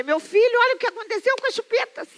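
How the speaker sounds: put-on a mother's sugary call to her small boy; sweet mother voice. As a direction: coax a toddler gently. Mother showing son burnt pacifiers.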